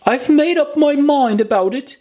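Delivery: put-on high childlike voice, close to tears; a little girl talking herself into staying put; high little girl fighting back tears; Alice, tearful. A narrator's reading.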